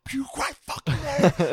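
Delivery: loud furious yell, high and slow; angry shout. Retold or imitated. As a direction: bark it out at her, furious. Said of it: "I'm getting mad".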